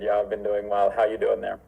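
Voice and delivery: normal talking voice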